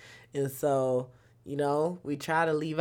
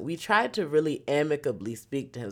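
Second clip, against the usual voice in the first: normal talking voice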